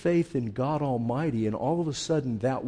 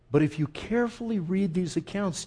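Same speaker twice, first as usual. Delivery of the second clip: usual speaking voice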